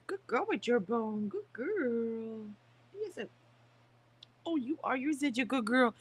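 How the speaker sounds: high sing-song praise for the puppy; pet-talk praise. Real, not acted. Owner praising puppy chewing her bone, in high cooing pet voice.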